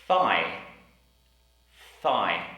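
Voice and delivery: Thigh with a blown, unvoiced th; Thigh, tongue between the teeth; careful th in thigh. Shown as a demonstration. Teacher drilling the word Thigh. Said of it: "We have".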